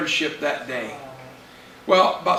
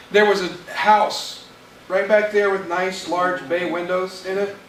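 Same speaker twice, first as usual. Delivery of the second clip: usual speaking voice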